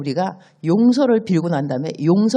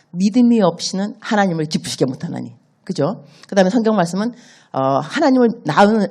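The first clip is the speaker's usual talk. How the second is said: usual speaking voice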